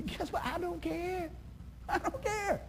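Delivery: high, light, breezy shrug; scene: pastor tells congregation he doesn't care, breezy; carefree. Acted. Lightly dismissive. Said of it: "carefree dismissal".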